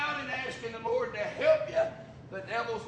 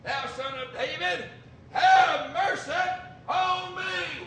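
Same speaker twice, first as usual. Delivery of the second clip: rising to a loud, slow plea; crying out; 4 semitones higher, 6 dB louder than usual. Acted. Blind Bartimaeus crying out to Jesus, loud. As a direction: call out desperately. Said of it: "he began to cry out and say".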